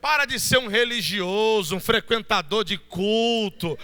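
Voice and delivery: fiery shouted sermon, high and loud; preaching at full force. Acted. Volta a pregar com força. (pregação inflamada, gritando)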